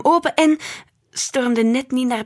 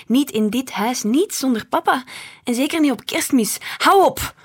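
raised voice building to a loud, high outburst; snapping angrily; 5 semitones higher, 5 dB louder than usual. A narrator's reading. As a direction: lash out, peaking on the last words. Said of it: "snauwde ik". (boos snauwend, steeds luider)

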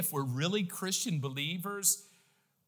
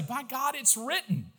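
bold, higher-pitched proclamation; bold declaration; 6 semitones higher than usual. Shown as a demonstration. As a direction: declare it with conviction. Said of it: "do it in a bold way".

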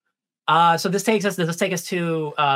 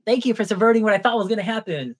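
a high, bright "Yeah!" then grateful gushing; delighted cheer; 6 semitones higher than usual. Retold or imitated. Fan telling cohosts her relief at the plot, higher delighted cheer. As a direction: cheer the writers on like they did you a favour. Thanking show for subverting expectations.

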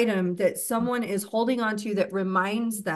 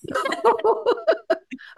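a sudden laugh that jumps high; high burst of laughter; 3 dB louder than usual. Real, not acted. Host laughs at herself for talking too long, high bright laugh. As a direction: laugh out loud at yourself for rambling.

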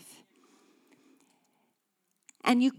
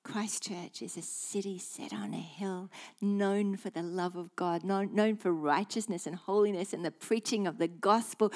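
normal talking voice

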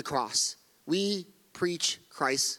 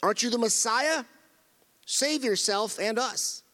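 mild mock taunt; 5 semitones higher than usual. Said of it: "hurled insults at him".

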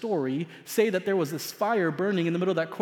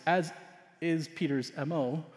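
normal talking voice

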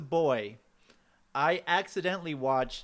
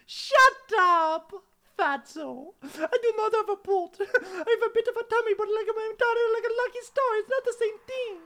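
higher indignant voice; high, louder mock-offended boyfriend; man's high retort; 5 dB louder than usual. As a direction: snap back at the teasing.

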